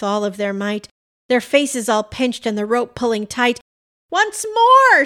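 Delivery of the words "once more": high, loud, drawn-out rallying shout; shouted urging. A narrator's reading. Pam and Rudy hauling the rope, rallying shout. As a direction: holler it with all your might. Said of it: "shouted Pam".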